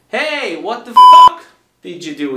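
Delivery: loud shouting at a mate; shouted question. Acted. Friend shouting a question at a mate, loud. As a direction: yell the question across at him.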